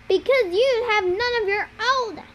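high, cutting comeback; angry retort. A narrator's reading. Annabeth rejecting Luke's offer to join him, angry retort. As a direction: throw it back at him, pitched high.

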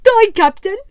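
a squeaky, eager yes-man; squat sailor. Acted. Squat sailor agrees with his captain, high and loud.

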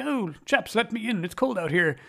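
high, pleading voice calling in from outside; Rex pleading outside. Retold or imitated. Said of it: "they suddenly hear the voice of Rex".

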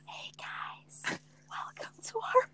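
very soft voiceless whisper; ASMR whisper. Shown as a demonstration. Trying an ASMR podcast intro, whispering. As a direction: whisper a podcast welcome into the mic. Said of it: "Just whisper".

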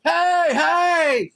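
high calling out. Retold or imitated. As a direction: call to friends far away. Calling from cop car.

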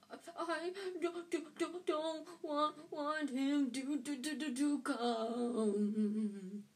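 high, stuttering pretend sobs; fake sobbing. A narrator's reading. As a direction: whine through fake sobs. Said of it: "between huge pretend sobs".

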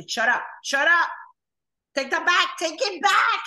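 loud, high playful yell at a friend; mock scolding yell. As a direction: shout it to shut her up, half joking. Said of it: "le hago así".

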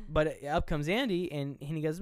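normal talking voice

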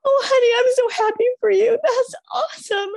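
high, gasping disbelief and delight; squealing, astonished joy; overjoyed celebration. Acted. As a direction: gush as if thrilled for a friend. Acting out celebrating a friend's news.

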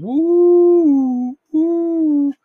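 high, loud, sustained "woo"; imitated siren wail. A narrator's reading. Reader imitates the passing fire engine, siren wail. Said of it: "a siren sounded".

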